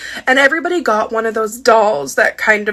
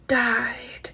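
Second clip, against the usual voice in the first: hushed whisper; 7 dB quieter than usual. Real, not acted. Storyteller revealing the doll died, whispered. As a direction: whisper the word for dramatic effect.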